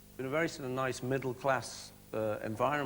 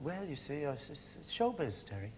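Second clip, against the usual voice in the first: put-on Welsh accent; 5 dB quieter than usual. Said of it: "slipping into a Welsh accent there".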